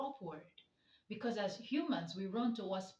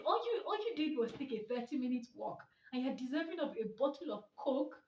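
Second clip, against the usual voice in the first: usual speaking voice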